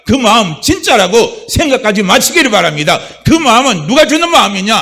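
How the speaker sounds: loud, climbing sermon cry; full-force preaching. Real, not acted. Pastor urging the congregation, loud and driving.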